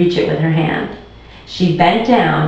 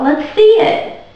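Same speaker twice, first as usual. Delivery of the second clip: eager; slower than usual. Acted. Andrew eager.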